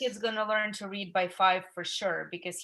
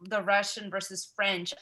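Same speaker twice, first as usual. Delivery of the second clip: normal talking voice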